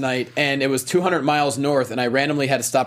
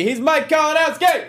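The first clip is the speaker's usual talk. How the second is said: much higher, hyped name call; excited intro; 12 semitones higher than usual. Acted. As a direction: announce him like a hype man. Hyping up co-host intro.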